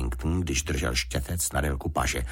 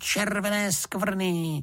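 put-on high, drawn-out boyish glee; slow, high, jubilant exclamation; Jonatán; 13 semitones higher, much slower than usual. A narrator's reading. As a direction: savour it slowly in a delighted child's high voice. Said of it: "zvolal Jonatán".

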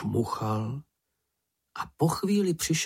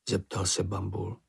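plain reading voice

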